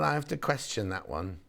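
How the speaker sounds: ordinary delivery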